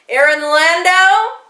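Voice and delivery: high, loud official call; officer's loud summons. A narrator's reading. Brown shirt confronting the father, loud formal summons. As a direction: announce the name like an arresting officer.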